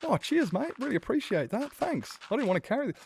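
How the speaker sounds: put-on very high, chirpy child; a boy thanking the driver who brought him home; gushing kid voice. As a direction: gush thanks like a little boy.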